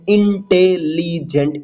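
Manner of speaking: Intelligent slowly split into four beats; slow, separated beats on intelligent; syllable by syllable. Shown as a demonstration. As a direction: break intelligent into in, te, li, gent. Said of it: "इसमें four beat है".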